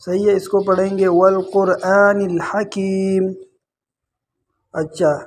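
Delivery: ordinary talk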